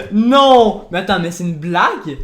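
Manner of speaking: loud, laughing no of delighted surprise; laughing disbelief. Real, not acted. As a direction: gasp out no in happy shock. Surpris par l'effet réussi. (non ! fort, en riant, incrédule)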